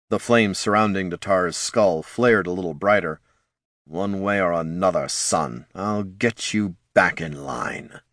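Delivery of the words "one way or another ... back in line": quiet menace. Acted. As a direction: threaten him quietly. Lich menacing son.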